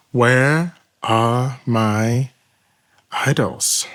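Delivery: drawn-out, very slow question with a pause before idols; slow after a deep breath. Retold or imitated. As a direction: stretch "Where are my" slowly, then drop on idols. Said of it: "took a big breath".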